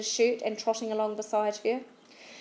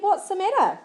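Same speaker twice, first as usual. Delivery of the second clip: startled; 9 semitones higher, 5 dB louder than usual. A narrator's reading.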